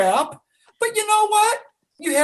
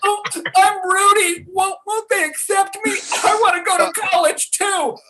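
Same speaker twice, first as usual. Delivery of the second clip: mock Rudy; 8 semitones higher than usual. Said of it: "Sounds says".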